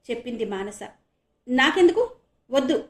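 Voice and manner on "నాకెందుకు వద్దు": firm loud refusal. Acted. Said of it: "గట్టిగా అన్నాడు ఆనంద్".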